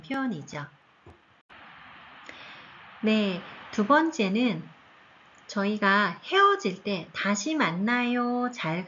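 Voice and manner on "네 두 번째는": usual speaking voice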